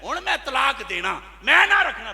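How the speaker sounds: high, loud mock old man; old man's outburst. Preacher mimicking a stubborn old man demanding a divorce, shouted. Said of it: "बूढ़ा खूंडी पकड़ कहता है".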